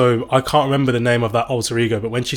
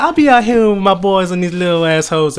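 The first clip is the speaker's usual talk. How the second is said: high, loud mock rapper flow; mock male rapper; 7 semitones higher, 8 dB louder than usual. Male rappers bragging with the boys, mock rapper. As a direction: send up a boasting rapper. Imitates rappers before the switch.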